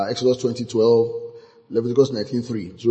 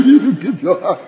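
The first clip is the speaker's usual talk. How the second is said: higher voice breaking up with laughter; laughing; 8 semitones higher, 4 dB louder than usual. Real, not acted. Preacher tickled by his own hand-cutting example, laughing as he talks. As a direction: laugh through the words.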